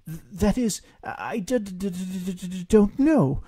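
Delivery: firmness crumbling into a stutter; stammering. Acted. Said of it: "his words shook that firmness all to pieces".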